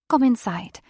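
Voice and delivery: plain reading voice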